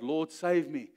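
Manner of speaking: ordinary talk, nothing marked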